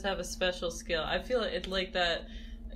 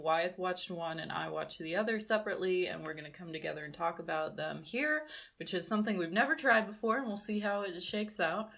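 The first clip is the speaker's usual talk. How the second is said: ordinary talk, nothing marked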